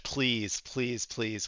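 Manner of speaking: ordinary talk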